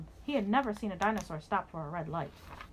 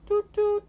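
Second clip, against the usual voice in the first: high, loud toot-toot horn; boat horn toot; 13 semitones higher, 10 dB louder than usual. Retold or imitated. Boats tooting in the harbour, loud high horn call. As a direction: honk it out like a little boat. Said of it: "went the boats".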